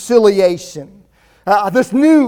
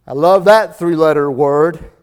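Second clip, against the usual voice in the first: ordinary talk, nothing marked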